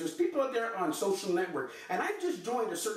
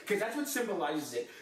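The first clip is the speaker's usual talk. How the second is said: ordinary talk, nothing marked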